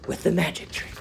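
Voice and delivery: usual speaking voice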